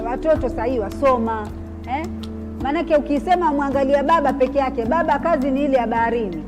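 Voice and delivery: ordinary delivery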